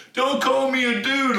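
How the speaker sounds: very high mock protest; indignant protest. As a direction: imitate an outraged objection at a high pitch. Repeated protest amid retold fight.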